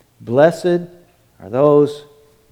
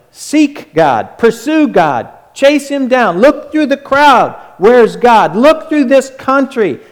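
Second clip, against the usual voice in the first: climbing higher and louder with urgency; rising fervent preaching; 7 semitones higher, 4 dB louder than usual. Real, not acted. Preacher urging the congregation, rising fervor. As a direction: build the chase, each line more urgent.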